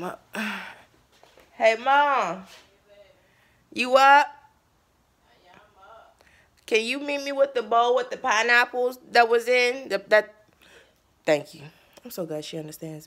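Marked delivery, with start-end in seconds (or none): 1.5-11.6 loud shout to another room; calling to mom. Real, not acted. Daughter shouting to mom in another room for the bowl. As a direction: call out so mom hears from upstairs.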